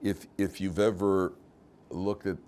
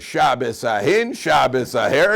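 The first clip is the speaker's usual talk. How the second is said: loud, slow mock incantation; deadpan it as a grand command for the punchline; mock miracle command; 4 semitones higher, 10 dB louder, slower than usual. Reform rabbi dismisses Shabbos to grab money, loud mock command.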